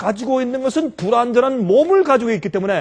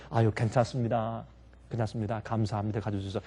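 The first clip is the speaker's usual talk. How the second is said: very soft, low forgiving reply; gentle acted reassurance; 11 semitones lower, 13 dB quieter than usual. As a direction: play it hushed and humble, brushing off the mishap.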